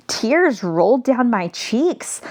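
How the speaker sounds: usual speaking voice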